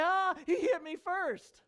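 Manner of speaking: high, drawn-out fake wail; hammed-up tearful tattling; mock crying child. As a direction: wail like a kid telling on someone. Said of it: "And he's gonna be crying".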